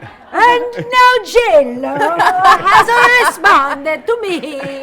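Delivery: put-on loud, slow, hammy Italian accent. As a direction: announce it grandly in Italian accent. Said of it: "I'm talking with an Italian accent".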